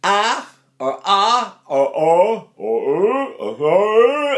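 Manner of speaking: ah, ah, oh, uh sounded out one by one; vowel options. Shown as a demonstration. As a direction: shape each vowel differently. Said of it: "Do I say".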